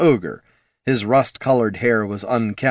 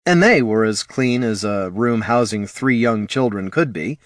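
ordinary delivery

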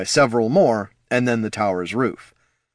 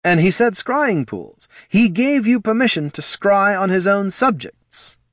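high, incredulous questioning; pointed character voice, pitched up; challenging official; 11 semitones higher, 4 dB louder than usual. A narrator's reading. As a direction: challenge them, voice raised high.

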